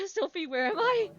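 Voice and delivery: laughing, high and loud; nervous laugh. Real, not acted. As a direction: laugh through the fear.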